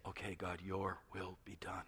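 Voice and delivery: a hushed whispered surrender; whispered prayer. Acted. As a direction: breathe the words like a prayer.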